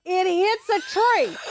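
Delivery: high, loud and slow, stretched out; incredulous telling. Real, not acted. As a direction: drag it out in disbelief. Laughing retelling of the mishap.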